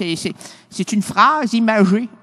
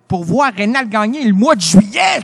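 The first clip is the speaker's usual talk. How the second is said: usual speaking voice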